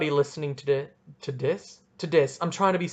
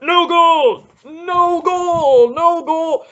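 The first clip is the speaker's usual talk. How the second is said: high, loud mock ref shouting no goal; mocking referee yell; 11 semitones higher, 8 dB louder, much slower than usual. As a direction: ham up a referee's bellowed call. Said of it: "the one who was like".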